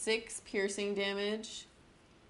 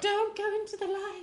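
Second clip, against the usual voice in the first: spooky voice; 4 dB louder than usual. Pretend. Joking ghostly warning to player.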